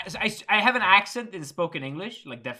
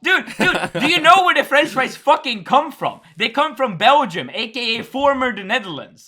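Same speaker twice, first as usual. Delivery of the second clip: loud, high, indignant ranting; heated rant; 6 semitones higher, 6 dB louder than usual. Real, not acted. Dutchman schooling friends on where fries come from, heated rant. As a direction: rant with raised pitch and force.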